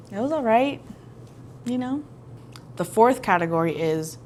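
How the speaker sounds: normal talking voice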